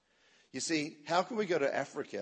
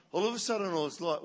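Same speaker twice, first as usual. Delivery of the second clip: usual speaking voice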